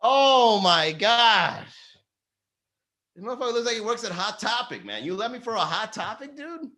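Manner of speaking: high, scoffing; mocking disbelief. Comic mocking ex's new boyfriend, high scoffing voice. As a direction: sneer at the Hot Topic guy.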